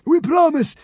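high, loud, panicky squeal; frantic grovelling cry; terrified pleading. A narrator's reading. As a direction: promise desperately, begging for mercy. Terrified consul submits to god.

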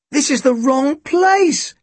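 put-on high, slow boyish protest; high-pitched insistence. A narrator's reading. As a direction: object in a high, drawn-out voice. Tim protests to inspector.

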